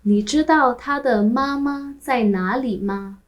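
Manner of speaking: usual speaking voice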